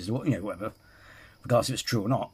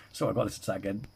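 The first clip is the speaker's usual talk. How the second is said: ordinary talk, nothing marked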